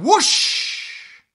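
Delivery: loud, rushing "whoosh"; explosive storybook sound effect; broomstick whoosh. A narrator's reading. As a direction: send the broom off with a big whoosh. Broom takes off.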